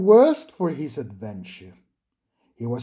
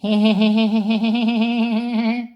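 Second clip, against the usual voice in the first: high mischievous chuckle; 6 semitones higher, 3 dB louder than usual. A narrator's reading. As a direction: give a cheeky little laugh. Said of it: "Percy chuckled".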